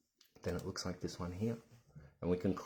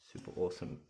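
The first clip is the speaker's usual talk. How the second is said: ordinary delivery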